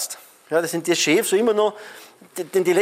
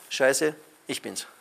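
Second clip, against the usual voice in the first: dejected view; 4 semitones lower, 5 dB quieter, slower than usual. Retold or imitated. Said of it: "zwei Sichtweisen".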